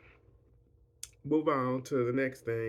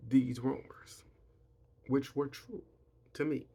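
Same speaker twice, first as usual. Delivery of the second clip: whispered aside. Real, not acted. Telling viewers a secret about the rumors, whispered.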